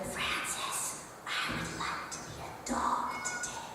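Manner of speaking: unvoiced shy child's whisper. Teacher imitating a shy kindergartner's whisper. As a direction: breathe it out like a tiny shy girl. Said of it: "Ava will whisper something to Francis".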